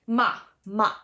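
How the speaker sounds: "ma" falling sharply, very emphatic; short emphatic down-tone "ma"; fourth tone. Shown as a demonstration. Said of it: "The fourth tone is a down tone".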